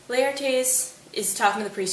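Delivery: ordinary talk, nothing marked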